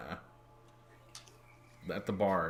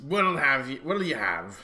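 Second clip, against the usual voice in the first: louder gruff barkeep; 4 semitones higher, 7 dB louder than usual. Acted. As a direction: call it across the bar. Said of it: "he says".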